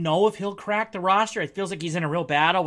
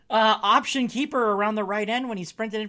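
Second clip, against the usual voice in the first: normal talking voice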